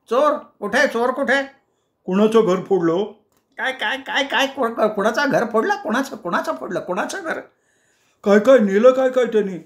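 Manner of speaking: high, excited neighbour voices; clamouring neighbours. Acted. Neighbours crowd the husband asking what was stolen, high and frantic. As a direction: pile on the frantic questions.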